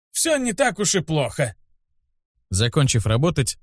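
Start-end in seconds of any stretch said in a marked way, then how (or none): 0.1-1.6 высокий сварливый стариковский голос; нехотя успокой ученика, ворчливо и высоко; сварливый старик. A narrator's reading. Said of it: "сварливо сказал он". (ворчание старого учителя)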